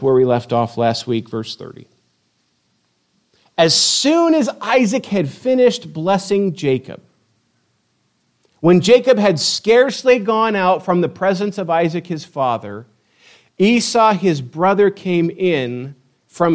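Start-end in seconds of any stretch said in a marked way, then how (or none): 3.6-12.9 higher, deliberate story narration; lifted, measured Bible reading; scripture reading. A narrator's reading. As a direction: read the verse out dramatically.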